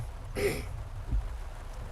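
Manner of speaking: dry hacking cough; coughing fit. Real, not acted. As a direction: keep coughing up the bug. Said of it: "I literally swallowed a bug".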